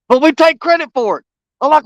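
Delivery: ordinary delivery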